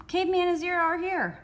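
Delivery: high, loud call back into the room; shouted. A narrator's reading. Squid shouting to the boys in the rec room, high and loud. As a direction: yell it to the guys inside. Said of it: "Squid shouted back to the rec room".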